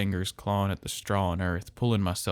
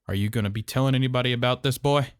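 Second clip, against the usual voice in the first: calm intense anger; 4 semitones higher, 6 dB louder, faster than usual. A narrator's reading. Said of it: "His voice had calmed down".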